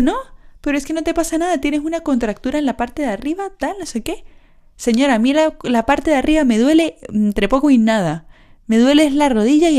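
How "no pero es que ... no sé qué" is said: doctor quoted. Retold or imitated. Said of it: "Y me dice".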